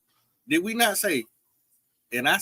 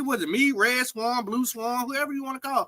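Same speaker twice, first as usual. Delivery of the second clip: high-pitched protest; 8 semitones higher than usual. Real, not acted. Guest insisting it was a private talk, high-pitched protest. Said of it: "the pitch of my voice go up and high".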